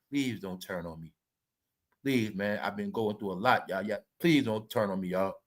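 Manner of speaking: low, soft mock begging; mock pleading. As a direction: plead with fans in a hushed imitation. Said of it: "begging and pleading".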